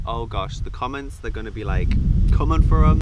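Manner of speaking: rising to a loud burst at the end; worried excitement. Retold or imitated. Vlogger fretting over the comments, building loud. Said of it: "And I'm like".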